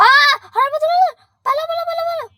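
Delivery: high panicked yelling to run; screaming at everyone to flee; shrieking as the horror doll closes in. Real, not acted.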